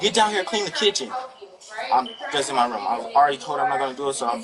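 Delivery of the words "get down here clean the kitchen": higher, mock yell of a nagging mother; a light imitation of mom shouting orders; imitating his mother. Teen son imitating his mother to the officer, mild mock yell. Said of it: "she's yelling her name".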